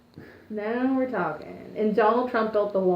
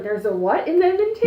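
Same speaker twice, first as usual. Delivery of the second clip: incredulous; 7 semitones higher, 5 dB louder than usual. Retold or imitated. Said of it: "So I was like".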